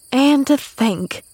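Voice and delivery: slow, high sigh of amazement; sighing wonder. A narrator's reading. Maid musing to Pollyanna, sighing wonder. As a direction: marvel slowly as Nancy. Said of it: "sighed Nancy".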